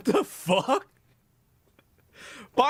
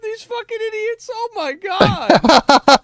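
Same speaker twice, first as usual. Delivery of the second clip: exasperated yell; 14 semitones higher, 10 dB louder than usual. Real, not acted. Exasperated outburst at idiots.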